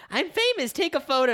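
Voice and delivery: high, pretend self-important order; mock diva demand. Friend suggesting a joke to beat awkwardness, high mock-famous demand. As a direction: pitch it up like a pretend star.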